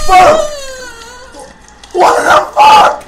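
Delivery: slow, very high shriek of shock; shocked screaming. Real, not acted. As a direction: scream the curse at full force. Reacting to the jump scare.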